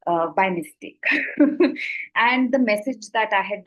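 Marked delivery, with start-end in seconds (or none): 1.1-2.1 breathy laugh. Real, not acted. Laughs at the scam.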